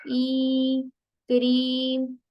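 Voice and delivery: ई held long and slow, then क्रीम with the same long vowel; long ई example. Shown as a demonstration. Teacher modelling the long ई in क्रीम for the class. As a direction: draw out ई in क्रीम. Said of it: "अब example देखें".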